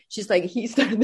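ordinary delivery

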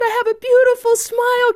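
put-on high, quick childlike boast; high eager child voice; little boy. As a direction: chirp it proudly like a small boy.

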